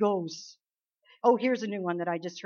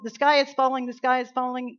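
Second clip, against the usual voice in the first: loud, higher frantic cry; yelled Chicken Little; 3 semitones higher, 5 dB louder than usual. Retold or imitated. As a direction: shout the alarm like a scared chick. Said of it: "yelled little Chicken Little".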